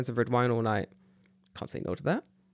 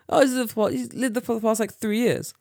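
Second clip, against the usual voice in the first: high woman voice; 8 semitones higher than usual. Retold or imitated. Woman's voice quoted.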